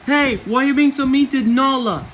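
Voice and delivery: usual speaking voice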